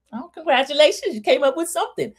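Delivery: high-pitched teasing praise; mock congratulations. Real, not acted. Recapper teases the cheesemaker over his word, mock congratulations.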